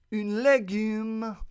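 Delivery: high, loud, drawn-out French; showy French. Acted. Podcaster echoing vegetable in French, slow showy French. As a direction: savour the French word with a flourish. Repeats the French word with flourish.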